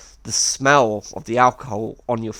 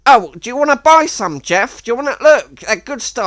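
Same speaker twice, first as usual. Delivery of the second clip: much higher, louder wheedling sell; pushy Miles; 9 semitones higher, 7 dB louder than usual. Retold or imitated. Miles pushing his stuff on Jeff, higher and louder. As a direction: hawk it like a pushy street seller. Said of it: "And then he was like".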